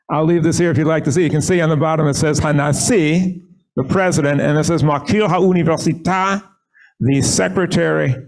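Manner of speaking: ordinary delivery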